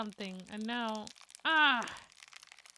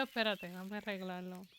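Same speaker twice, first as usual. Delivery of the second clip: ordinary talk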